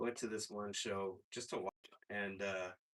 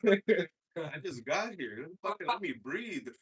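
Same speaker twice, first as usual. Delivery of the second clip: laughing; 7 semitones higher, 10 dB louder, slower than usual. Retold or imitated. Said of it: "I said".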